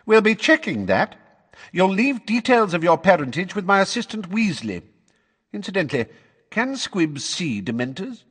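high, pointed official voice; officious suspicion. Acted. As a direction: make it a pointed warning. Suspicious official questions the witness.

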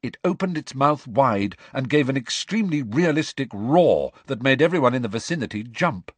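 usual speaking voice

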